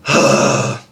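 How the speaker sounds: airy rasp; breathy scream practice. Shown as a demonstration. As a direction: push breath out in a rough exhale. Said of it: "So you're gonna be like".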